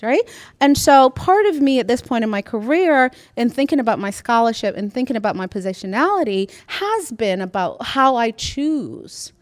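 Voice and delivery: usual speaking voice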